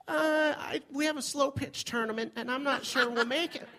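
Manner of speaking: high-pitched mock female voice; imitating a hesitant woman. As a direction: put on her high voice for the excuse. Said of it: "she just kinda went".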